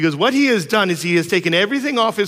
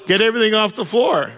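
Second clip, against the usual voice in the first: son arguing; 8 semitones higher than usual. Retold or imitated. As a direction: argue like a clever child who found a loophole. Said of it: "He's like".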